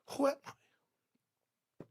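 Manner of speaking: slow whisper trailing away; hushed self-doubt. Acted. Preacher voicing people afraid to shine, hushed whisper. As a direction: whisper it, barely voiced. Same question repeated, hushed.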